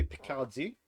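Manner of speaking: Picardie said in a tentative French accent; attempted French accent. Shown as a demonstration. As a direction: give Picardie a light French pronunciation. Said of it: "trying to do that with a French accent".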